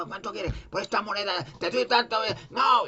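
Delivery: high, rising into a shout, a market trader haggling; haggling merchant. Retold or imitated. Market trader haggling in the temple, rising to a shout. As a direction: bark out prices like a rastro seller.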